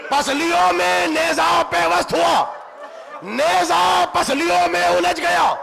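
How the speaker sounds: rising, shouted elegy, slowing on each blow; lament preaching at full force. Acted. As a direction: cry the scene out to the mourners. Climax of majlis lament recitation.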